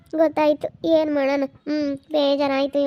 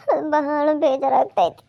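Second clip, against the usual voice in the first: crying; 4 dB louder, slower than usual. Acted. Woman upset about lost inheritance.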